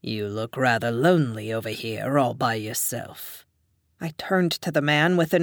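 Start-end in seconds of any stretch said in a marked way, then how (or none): 0.0-3.5 lower, measured male voice; a smooth dom leaning in at a bar; stern English man. A narrator's reading. Man chatting up the narrator, lightly lowered voice.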